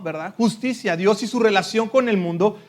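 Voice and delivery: normal talking voice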